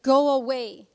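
loud retold dismissal; imitating a rude, loud send-off; rude brush-off. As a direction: shove someone off with the words. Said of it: "like, rudely pushing me away".

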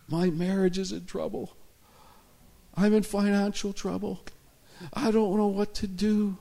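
high-pitched tearful wail; acting out a man sobbing his troubles; imitated crying. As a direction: blurt it out as if weeping. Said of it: "and he's crying".

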